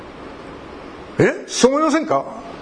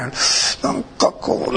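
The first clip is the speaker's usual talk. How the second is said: mumbled prayer; 4 semitones higher, much slower than usual. Shown as a demonstration. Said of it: "なんかこんな感じでお祈りを済ませる".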